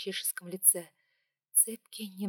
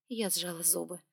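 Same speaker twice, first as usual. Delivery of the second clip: ordinary delivery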